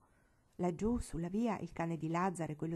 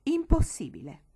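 a high, loud, disdainful "Impossibile"; haughty reply; 7 semitones higher, 10 dB louder than usual. Acted. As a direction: dismiss it from above like a proud grandmother. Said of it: "la quale rispose altezzosamente".

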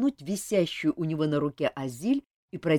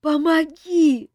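high, slow, pleading cry; plaintive plea; 10 semitones higher, 8 dB louder, much slower than usual. A narrator's reading. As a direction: beg pitifully for help. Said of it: "жалобно попросила Азель".

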